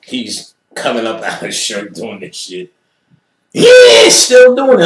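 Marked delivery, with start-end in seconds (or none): 3.5-4.9 very high, loud frantic shriek. Retold or imitated. Ellis coming out of his shirt, a very high, loud shriek. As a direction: shriek it wildly.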